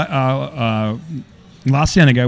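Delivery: normal talking voice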